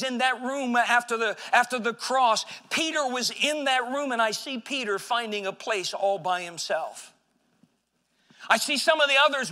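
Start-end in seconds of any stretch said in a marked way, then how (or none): none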